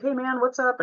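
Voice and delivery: bright, high, friendly hello; cheerful greeting. Retold or imitated. Crew guy high-fives the boy, upbeat high hey. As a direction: greet the kid with an upbeat lift.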